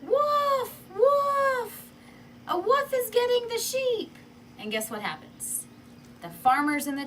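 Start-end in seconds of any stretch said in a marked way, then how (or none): 0.0-4.1 high, loud alarm cry; shout. Acted. Shepherd boy on the mountain calling the town, loud fake alarm. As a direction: yell it from the mountain, much higher and louder. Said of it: "he yells".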